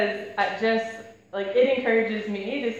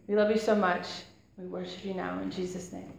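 soft, hushed congregational prayer; 5 semitones lower, 7 dB quieter than usual. Real, not acted. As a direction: pray quietly and humbly over the room. Opening prayer before the sermon.

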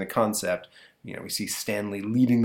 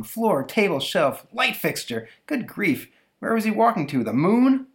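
much higher mock-exasperated mother; exasperated mom; 11 semitones higher, 3 dB louder than usual. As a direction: sigh over the mess like a fed-up mom.

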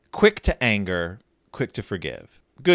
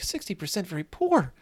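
stunned; 8 semitones higher than usual. Retold or imitated. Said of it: "Sister is stunned".

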